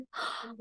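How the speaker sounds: sharp unvoiced gasp of realisation. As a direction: gasp as it dawns on you. Realises guest is the prince.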